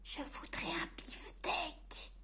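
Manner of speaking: shy breathy request; whispered. Acted. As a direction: ask the butcher in a frightened whisper. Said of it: "murmurait Monsieur Silence".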